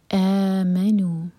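آمنوا with the first a long; madd badal. Shown as a demonstration. Teacher models آمنوا, slow recitation. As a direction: stretch the opening آ of آمنوا slowly. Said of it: "یہ ہے مد بدل".

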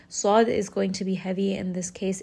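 ordinary talk

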